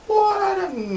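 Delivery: very high startled squeak; high startled what; surprised. Real, not acted. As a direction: jolt at your own attempt. Reacting to own attempt.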